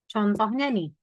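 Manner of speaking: ordinary talk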